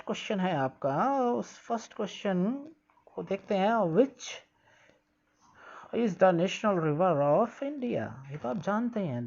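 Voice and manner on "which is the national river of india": slow, careful read-aloud of English text; slow textbook reading. Shown as a demonstration. Teacher reading the textbook line slowly to pupils. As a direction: read each word out slowly for the class.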